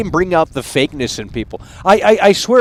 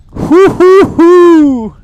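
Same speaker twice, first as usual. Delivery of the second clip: loud high hoo hoo hoos; loud high whooping hoots; hype hoots; 12 semitones higher, 9 dB louder, much slower than usual. Acted. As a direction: hoot like a hype man. Hype owl hoots before dissection.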